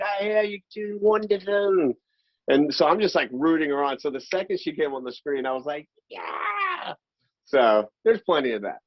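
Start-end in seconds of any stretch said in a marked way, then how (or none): 0.0-1.9 British accent imitation. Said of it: "She's like". 6.1-6.9 high cheer. Retold or imitated. Actor cheering when she came on screen, high whoop.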